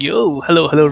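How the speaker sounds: normal talking voice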